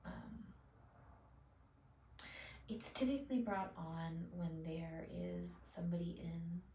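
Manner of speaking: ordinary delivery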